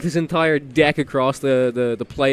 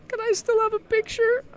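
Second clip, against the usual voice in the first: sweet fan voice. Retold or imitated. Mimicking opponent after winning.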